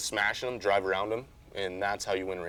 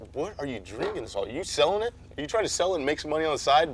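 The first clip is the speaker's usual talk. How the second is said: incredulous sponsor query; 6 semitones higher, faster than usual. Retold or imitated. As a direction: pitch climbing into mock suspicion. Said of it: "they always email me".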